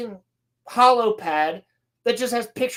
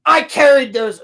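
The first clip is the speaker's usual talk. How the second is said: indignant retort; 3 semitones higher, 8 dB louder than usual. Retold or imitated. She argues with Patriarchy girl, mock indignant. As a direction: hit it hard, as if arguing. Retold argument between two characters.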